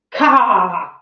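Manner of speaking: loud mocking caw cry; mock crow caw. A narrator's reading. Sheep mocking the hound with a loud caw. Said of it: "one of them shouted".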